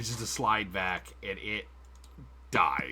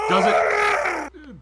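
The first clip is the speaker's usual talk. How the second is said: sudden high squeak, much louder; surprised squeak; 10 dB louder than usual. Real, not acted. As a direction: blurt it out high and loud.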